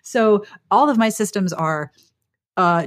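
ordinary talk, nothing marked

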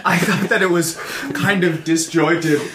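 talking through giggles; laughing. Real, not acted. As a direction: laugh while answering. Laughing at recording mishap.

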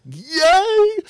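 giddy yay. Retold or imitated. Giddy when she touches his knee.

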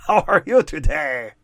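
loud, high, hammed-up French Canadian caller; mock-jolly French Canadian bellow; outrageous French Canadian accent. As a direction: boom it out in an over-the-top Québécois accent, chuckling. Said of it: "so outrageous with his, like, accent".

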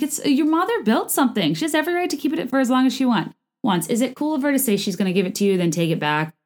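ordinary talk

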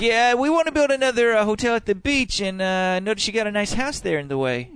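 high, loud, falsely friendly pitch; smug developer. Pretend. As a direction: sell the eviction cheerfully. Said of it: "It's like".

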